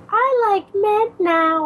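put-on high, slow childlike voice; dopey kid saying it slowly; Ralph Wiggum. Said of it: "Ralph's like".

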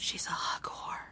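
a quick teasing whisper; ninja whisper. Real, not acted. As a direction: whisper it behind her back. Teasing aside about co-host.

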